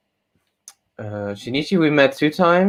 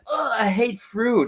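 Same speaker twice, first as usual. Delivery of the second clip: quoting a friend; 10 semitones higher, slower than usual. Retold or imitated. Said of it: "he says".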